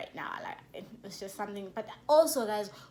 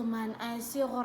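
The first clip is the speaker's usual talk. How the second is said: usual speaking voice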